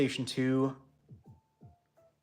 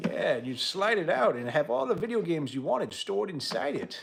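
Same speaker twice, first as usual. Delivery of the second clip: put-on old prospector; 5 semitones higher than usual. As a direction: croak like a grizzled old-timer. Old-timer bit for viewers.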